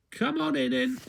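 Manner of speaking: sing-song high twin with a smile; smiling high little twin. A narrator's reading. Twin invites him in.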